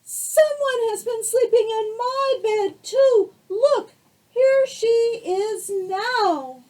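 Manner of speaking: high, loud, slow wailing little bear; tiny bear crying out in alarm; squeaky baby bear wail. Acted. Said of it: "Baby Bear cried out".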